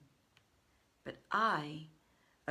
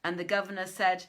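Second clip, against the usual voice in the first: ordinary delivery, nothing marked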